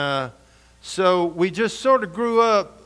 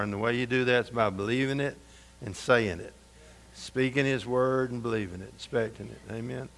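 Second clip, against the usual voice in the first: soft preaching; 4 semitones lower, 6 dB quieter than usual. Acted. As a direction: draw them in with a hushed sermon. Quiet preaching passage.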